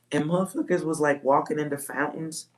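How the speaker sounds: ordinary talk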